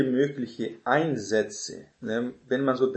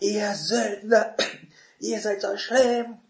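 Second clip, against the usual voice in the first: much higher, slow put-on voice spitting contempt; mock hateful; 9 semitones higher, slower than usual. Shown as a demonstration. Hostile preacher scolding the crowd, mock hateful. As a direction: hurl it at them with a hateful face. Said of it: "hasserfüllten Gesichtsausdruck".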